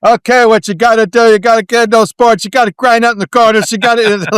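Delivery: loud, high, barking hockey-coach rant; Don Cherry impression. Imitating Don Cherry coaching the kids, loud and high. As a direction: bark orders like a blustery old hockey commentator. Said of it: "should have been played by, uh, Don Cherry".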